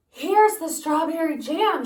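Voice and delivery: high, loud excited call; shouting Jack. Acted. Boy shouting to his mother with the jam, high and loud. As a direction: shout it out proudly. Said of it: "shouted Jack".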